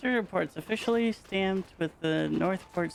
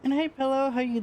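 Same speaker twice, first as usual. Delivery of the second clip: much higher, sing-song hello; squeaky sweet hello to chat; cutesy greeting; 10 semitones higher than usual. Real, not acted. Greeting viewers by name.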